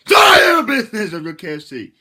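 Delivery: very loud, high outburst; indignant shout. Pretend. Telling off viewers who question KFC in Jamaica, indignant yell. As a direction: yell back at them, starting high and coming down. Snaps back at imagined doubting viewers.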